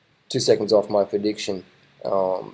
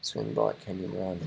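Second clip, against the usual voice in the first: breathy, voiceless murmur; whispered; 2 semitones lower, 12 dB quieter than usual. Shown as a demonstration. Slogan repeated to himself, whispered.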